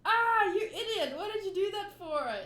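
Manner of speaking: high, loud scolding outburst; crew yelling. Acted. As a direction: yell at him like an upset crew. Everyone reacts.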